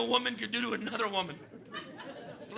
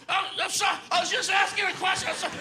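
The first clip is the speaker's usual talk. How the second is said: put-on high apologetic drunk; 8 semitones higher, much faster than usual.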